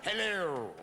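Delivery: theatrical British hello; lightly boozy British stage voice; drunken British accent. Acted. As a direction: draw out a tipsy British greeting. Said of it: "I just acquire this British accent".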